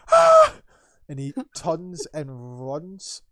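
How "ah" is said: very high, loud shriek; piercing scream played for laughs; mock manly scream. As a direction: let out a comically high scream. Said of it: "extremely manly scream".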